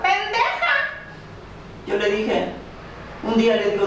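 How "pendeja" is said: mother yelling an insult. Retold or imitated. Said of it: "una señora que le decía".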